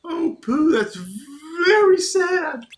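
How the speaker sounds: sad Piglet. Acted. Piglet sad that Pooh forgot him, mock crying. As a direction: whimper it like a heartbroken piglet. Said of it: "Can't do Piglet's voice either".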